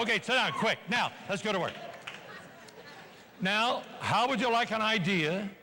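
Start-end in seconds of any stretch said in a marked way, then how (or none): none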